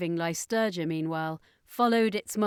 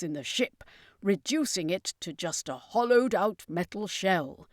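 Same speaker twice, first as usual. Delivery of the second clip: character voice explaining the weapon to the crew; circus master explaining; 4 dB louder than usual. Acted.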